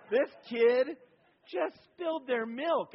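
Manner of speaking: high, loud and slow; exasperated disbelief. Retold or imitated. Dad protesting the overreaction, loud and incredulous. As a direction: stretch it out in disbelief. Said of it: "And I'm thinking".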